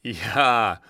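lautes, höhnisches Ja; spöttisch auftrumpfen. A narrator's reading. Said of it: "höhnte er". (Kapitän verhöhnt die Gefangene, höhnisch)

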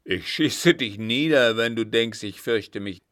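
loud, furious threat; angry threat. Acted. Vagabond threatening Berrier, shouting angrily. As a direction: threaten to shoot him down.

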